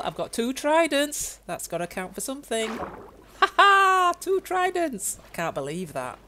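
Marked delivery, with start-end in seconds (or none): 2.7-5.2 high gleeful crow; triumphant. Real, not acted. Gamer crowing over his two tridents, high gleeful triumph.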